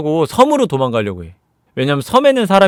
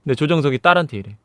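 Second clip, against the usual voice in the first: plain reading voice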